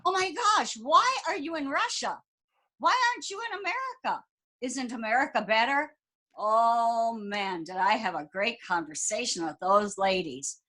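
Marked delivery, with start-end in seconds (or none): 0.1-5.9 imitating incredulous women, pitched high; high, excited retold disbelief; astonished questioning. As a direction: fire off amazed questions in a high voice. Amazed ladies question the American.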